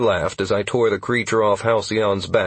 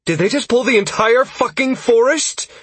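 alarmed shout; 14 semitones higher, 5 dB louder than usual. A narrator's reading. Sierra alarmed.

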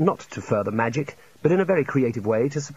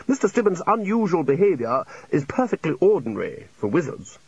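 higher, emphatic character voice; lecturing senior wizard; 4 semitones higher than usual. Acted. Senior wizard correcting his junior, loftily emphatic.

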